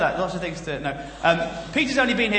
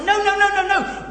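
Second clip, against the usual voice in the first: high, loud string of protests; alarmed protest; 10 semitones higher, 5 dB louder than usual. Acted. Apostles stopping a crowd worshipping them, high alarmed protest. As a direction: protest in hammed-up alarm, pitched right up.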